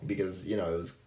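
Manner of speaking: ordinary talk, nothing marked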